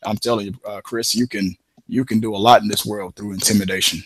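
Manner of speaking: imitating an intimidating old coworker, low and hushed; Braun impression. Speaker imitating his coworker Braun's advice on intimidation, low and soft. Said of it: "I'm trying to do a Braun impression".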